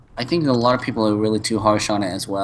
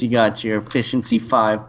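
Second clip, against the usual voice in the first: ordinary delivery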